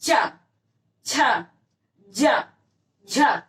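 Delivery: चा, छा, जा, झा as isolated emphatic syllables; sound demonstration. Shown as a demonstration. Teacher demonstrating palate sounds, चा, छा, जा, झा sounded out separately. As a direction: give चा, छा, जा, झा singly with clear pauses. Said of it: "जैसे आप बोलते हो".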